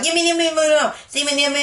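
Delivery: high-pitched, insistent imitation scream; mock screaming. Retelling the girl's interview outburst, high mock scream. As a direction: rattle it out like a screaming kid. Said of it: "she's just screaming out".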